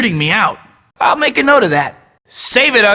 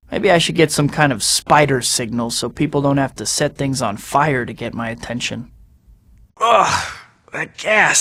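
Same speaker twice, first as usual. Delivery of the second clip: usual speaking voice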